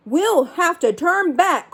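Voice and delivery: slow called-out warning; calling out. Acted. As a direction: call it across the deck. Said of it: "called another".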